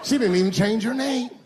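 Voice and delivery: high-pitched mock disbelief; incredulous. Comedian mocking Rachel's commitment, high incredulous voice. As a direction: say it as an astonished complaint. Repeats punchline two ways.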